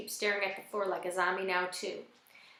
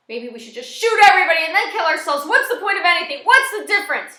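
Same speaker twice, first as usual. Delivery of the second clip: high, loud, hopeless outburst; frantic shouting at full force; desperate yelling; 9 semitones higher, 15 dB louder than usual. A narrator's reading. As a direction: yell it as if past all caring. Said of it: "Frosty yells".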